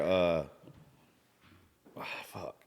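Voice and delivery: normal talking voice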